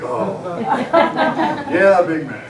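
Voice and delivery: laughing awkwardly through a short reply; nervous chuckle while ordering; embarrassed laugh, caught off guard. Retold or imitated. Awkward reply to recognizing lady.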